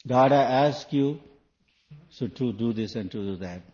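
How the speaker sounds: loud, bossy demand to God; demanding prayer. Shown as a demonstration. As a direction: order God about like a servant. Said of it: "you know how they ask?".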